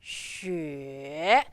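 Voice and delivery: XUE going far down and then up; third tone. Shown as a demonstration. Teacher showing down and then up, dipping xue. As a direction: draw xue out through a low dip. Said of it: "third tone going down and then up".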